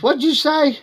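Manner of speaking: high, baffled city guy asking; puzzled city man. Retold or imitated. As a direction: play the confused outsider, pitched high.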